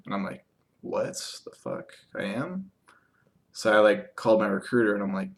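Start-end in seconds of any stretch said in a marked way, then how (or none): 0.8-2.7 drawn-out, softer mock shock ending high; retold disbelief. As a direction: replay a baffled reaction, voice rising at the end. Retold shock at unexpected news.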